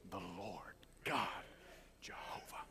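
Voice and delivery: hushed whisper of God's name; whispered in awe; reverent whisper. Real, not acted.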